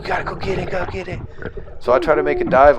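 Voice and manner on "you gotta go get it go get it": high, frantic urging; freaking out. Retold or imitated. Retelling my freaked-out brother urging me back down. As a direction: beg him to go get it right now. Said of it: "he's, like, freaking out".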